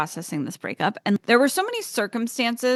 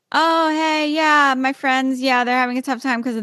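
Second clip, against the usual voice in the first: lightly voiced, higher, breezy imitation of the boyfriend; mock boyfriend; 5 semitones higher, 3 dB louder than usual. Mocking boyfriend's casual answer.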